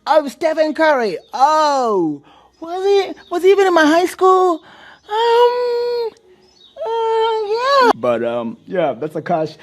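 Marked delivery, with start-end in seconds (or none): 0.1-7.9 very high, whiny fake crying voice; mock Ayesha. Podcaster mocks Ayesha's interview answer in a high fake cry. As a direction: squeal it out like a fake sob.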